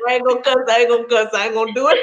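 fast, laughing through it; quick giggly promise not to cuss; laughing refusal. Real, not acted. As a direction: rush it out while laughing. Stops herself cussing, laughing.

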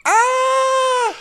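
short high yelp; mock scream.

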